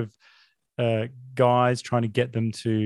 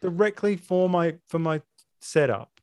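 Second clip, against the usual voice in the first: screaming at a spider; 9 semitones higher than usual. Real, not acted.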